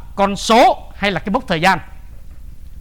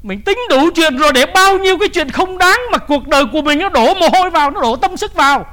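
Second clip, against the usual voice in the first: raised, high preaching voice; forceful preaching; 8 semitones higher, 5 dB louder than usual. Acted. Preacher challenging listeners on misplaced effort, forceful. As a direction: press it on the congregation, loud and high.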